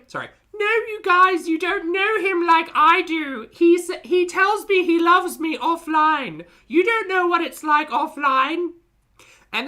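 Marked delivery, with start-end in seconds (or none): 0.5-8.7 higher and louder, slow mock pleading; mocking imitation. Mocking the streamer defending her boyfriend to chat, higher, louder and slow. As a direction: parody her insisting he loves her.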